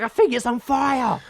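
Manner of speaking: high, loud alarm. Retold or imitated. Smoke from the lawnmower, a high loud alarmed cry. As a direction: sound the alarm, half laughing. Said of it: "and I'm like".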